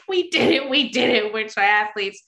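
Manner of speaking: high, loud excited cheer, easing down; triumphant celebration. Retold or imitated. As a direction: retell a giddy victory shout to your buddies. Retold celebration with fellow beginners.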